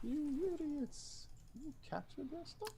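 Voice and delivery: silly high voice. Pretend. Silly voice after laugh.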